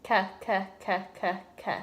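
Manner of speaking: "k, k" said as short clicks of the back tongue; repeated k demo. Shown as a demonstration. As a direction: tap out "k" sounds. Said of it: "I want you to say".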